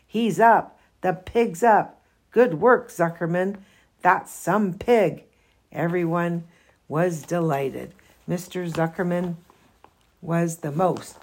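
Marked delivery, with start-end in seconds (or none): 0.1-5.2 excited cheer. Acted. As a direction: cheer the pig on. Crowd cheering the pig.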